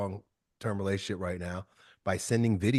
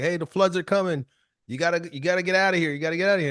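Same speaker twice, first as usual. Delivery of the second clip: higher, urgent warning at the door; urgent neighbour; 6 semitones higher, 4 dB louder, faster than usual. Retold or imitated. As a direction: hurry him out before the flood. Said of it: "It was like".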